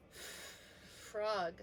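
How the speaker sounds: long F held before the rest of Frog; stretched first sound. Shown as a demonstration. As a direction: draw out the F in Frog. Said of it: "Sounds like our letter F".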